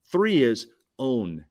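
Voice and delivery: слово «own» медленно и с нажимом; stress on own. Shown as a demonstration. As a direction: lean on own, slow it down. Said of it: "Emphasize the word own".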